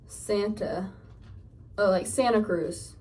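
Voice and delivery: ordinary talk, nothing marked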